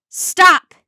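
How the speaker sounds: very loud, high desperate shout; yell. A narrator's reading. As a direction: yell to halt an attacker. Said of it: "he yelled".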